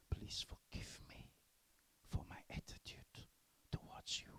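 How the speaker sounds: soft, hushed plea; whispered apology. Retold or imitated. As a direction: whisper it humbly. Teacher's whispered apology retold.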